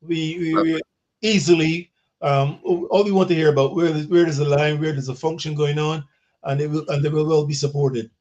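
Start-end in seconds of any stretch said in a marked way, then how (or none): none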